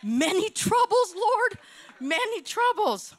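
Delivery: half-joking incredulous outcry; mock exasperated protest. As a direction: complain to the Lord in mock outrage. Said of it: "I'm like".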